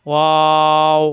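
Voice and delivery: ওয়াও stretched long on rounded, open lips; rounded, held waw. Shown as a demonstration. Teacher demonstrating lip position to learners, rounded waw. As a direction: hold ওয়াও without closing the lips. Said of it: "দুই ঠোঁট একত্র হবে না".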